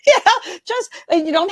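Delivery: high, loud, laughing through it. Real, not acted. Guest laughs about her own big silly style. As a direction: giggle while you explain. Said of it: "big and silly like I do".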